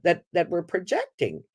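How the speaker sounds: usual speaking voice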